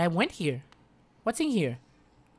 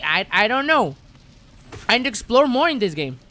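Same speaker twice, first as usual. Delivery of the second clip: high, loud, insistent protest; worked-up explaining; 9 semitones higher, 7 dB louder than usual. Real, not acted. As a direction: defend yourself in a raised, excited voice. Defending choices to viewers.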